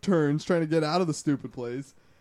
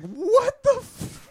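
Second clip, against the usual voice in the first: much higher, slow, stretched what the f; stunned disbelief; 4 dB louder, much slower than usual. Retold or imitated. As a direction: hang on every word in disbelief. Realizes it was a tow truck.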